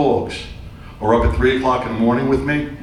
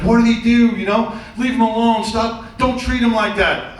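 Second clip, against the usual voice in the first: frantic wife; 8 semitones higher than usual. Retold or imitated. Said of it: "She's like".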